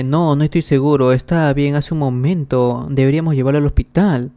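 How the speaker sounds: ordinary delivery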